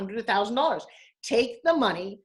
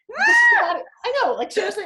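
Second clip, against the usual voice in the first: excited squeal; 13 semitones higher, 9 dB louder than usual. Real, not acted. Excited squeal at turning sixty.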